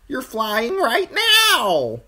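very high, loud, drawn-out squawk; insistent crow. A narrator's reading. Crow insisting to falling Bran, piercing squawk. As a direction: squawk it, stretching the last word.

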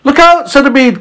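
high-pitched warning cry. A narrator's reading. James warning the centipede near the edge, crying out. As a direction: call out a sudden alarm. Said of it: "cried James".

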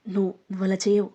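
plain reading voice

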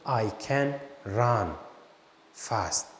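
I can run fast, very slow and clear; slow teaching demo. Shown as a demonstration. Teacher models a sentence for Turkish learners, slowly. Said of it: "Mesela ne diyorum?".

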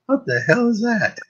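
put-on high, soft little girl's shocked question. Girl spirit in a man's body, high and shocked. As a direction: squeak it like a startled small child. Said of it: "came in a very different voice".

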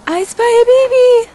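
very high squeaky sing-song; high cartoonish baby-talk call; squeaky I spy. Playful I-spy game with baby.

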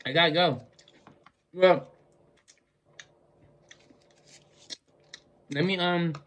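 talking while chewing; chewing with lips closed; mouth full. Real, not acted. Seen 3: Lips closed, cheeks working on food while talking; licks fingers.

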